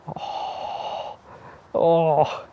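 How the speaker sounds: breath out, then a high oh; pained groan. Real, not acted. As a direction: let the pain out in two ohs. Recovering from knee hit.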